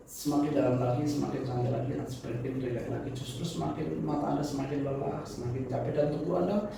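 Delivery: soft, steady hypnotist voice; hypnotic suggestion. Acted. Deepening suggestion to subject.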